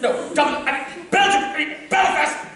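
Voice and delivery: blurting out frustrated fixes at a dense producer; frustrated flustered outburst; exasperated shout. Acted. Exasperated playwright correcting himself.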